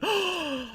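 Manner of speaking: sharp, very high theatrical gasp; mock gasp. Vlogger fake-gasping at the article's claim. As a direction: fake a shocked intake of breath.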